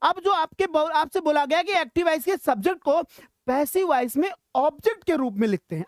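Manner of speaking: ordinary talk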